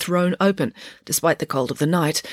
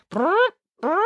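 blown raspberry, twice; fart noises; 13 semitones higher than usual. A narrator's reading. Boy mocks schoolgirl's flatulence, rude raspberry noises. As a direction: imitate a whoopee cushion with the lips. Boy imitates whoopee cushion farts.

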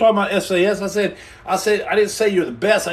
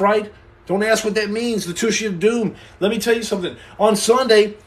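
ordinary talk, nothing marked

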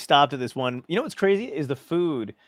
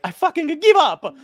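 high, loud mock-Italian exasperation; slow it down and throw in the towel in Italian accent; hammed-up Italian outburst; Roberto Benigni; 8 dB louder than usual. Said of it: "he was like".

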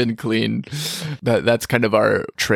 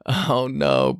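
ordinary delivery

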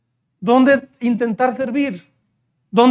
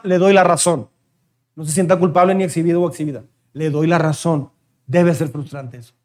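lower, softer reassurance to parents; gentle reassurance; 7 semitones lower, 4 dB quieter than usual. Real, not acted. Pastor consoling frustrated parents, softly. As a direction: ease their guilt gently.

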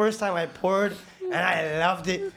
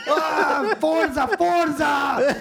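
higher, louder, drawn-out Forza; mock yelling; 10 semitones higher, 6 dB louder, much slower than usual. Tiago yelling at rookie to hurry up, mock shout. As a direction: push them to hurry with a shout. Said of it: "Tiago is yelling at me like".

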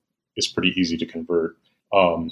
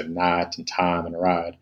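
imitated Southern accent, night, time and ride said as "naht", "tahm", "rahd" with a flat ah; 4 dB quieter, slower than usual. Lecturer imitating how people mock Southerners, a light Southern accent. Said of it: "when people imitate Southerners".

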